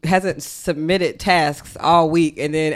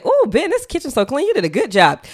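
higher, sing-song praise; mock praise; 6 semitones higher than usual. Wife replays praising her partner, higher and sing-song. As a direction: gush over the clean kitchen. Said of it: "So I say".

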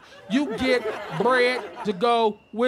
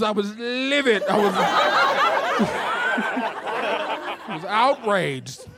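"livid" said with fussy lady flair, laughing; prim livid again. Pretend. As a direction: say "livid" primly while amused.

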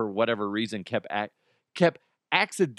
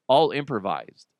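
ordinary talk, nothing marked

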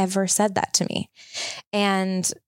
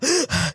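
sharp gasp for air while crying; a choked crying gasp; 6 semitones higher than usual. Retold or imitated. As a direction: gasp as if sobbing and unable to speak. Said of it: "I go".

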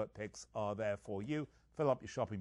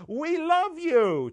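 high, slow, sing-song and loud; sarcastic mock farewell; 12 dB louder, much slower than usual. Mocking the team's parting message to its fans, sarcastic sing-song. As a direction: gush it with dripping sarcasm. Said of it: "What a great way to say".

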